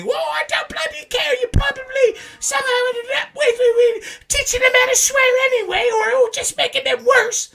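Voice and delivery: loud, very high falsetto mum voice; scolding English mother. Retold or imitated. Mother blaming her son for teaching parrots swearing, loud falsetto scolding. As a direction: wail it in a hammed-up English mum screech. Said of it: "Well, Mama".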